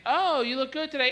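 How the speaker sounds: lightly voiced sugary praise; mock girly. Comic telling a girl she looks good, mock sweet.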